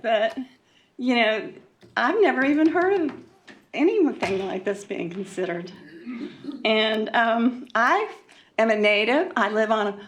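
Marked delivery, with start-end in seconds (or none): none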